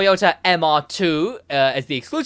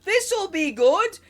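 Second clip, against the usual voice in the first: mock hopeful players; 9 semitones higher, slower than usual. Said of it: "people were like".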